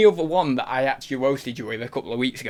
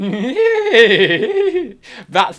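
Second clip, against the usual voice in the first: high, loud imitated giggle; badly faked high-pitched giggle; giggling impression; 7 semitones higher, 8 dB louder than usual. Streamer trying to mimic her giggle, mock giggling. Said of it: "and then start giggling".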